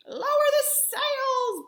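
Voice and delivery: high, loud, slow storm shout. Acted.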